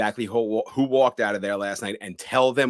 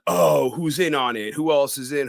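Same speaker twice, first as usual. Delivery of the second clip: mock alarm; 6 semitones higher, 3 dB louder than usual. Retells first reaction to the line.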